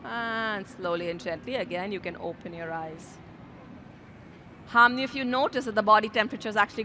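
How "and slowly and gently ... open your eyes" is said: softer, easing the room out of stillness; a soft, calm cue to open the eyes; gentle guided voice. Acted. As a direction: bring them back gently, hushed. Easing class out of breathing.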